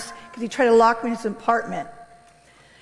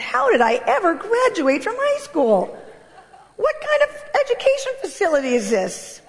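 exasperated disbelief; 8 semitones higher than usual. Retold or imitated. Mocking her own schooling.

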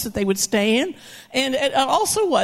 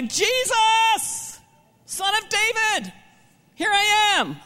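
shout; 10 semitones higher, slower than usual. Shown as a demonstration. Blind beggar shouting for Jesus over the crowd, high call. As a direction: cry out across the crowd with all you have. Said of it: "He begins to shout".

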